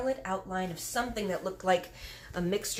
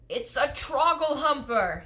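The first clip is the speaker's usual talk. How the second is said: louder, higher, slow giant shout; 7 semitones higher, 7 dB louder than usual. Acted. Giant alarmed at the nightmare jar, louder shout. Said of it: "he shouted".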